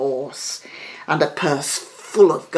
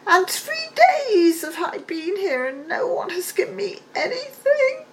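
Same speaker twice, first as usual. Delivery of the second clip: high, weepy crone voice; an old hag whimpering about hunger; high sobbing witch voice; starving old beggar woman. A narrator's reading. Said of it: "she said again".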